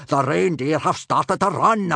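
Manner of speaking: Viking teacher yelling across the moor; gruff Gobber shout. Acted. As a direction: bark the warning to the riders.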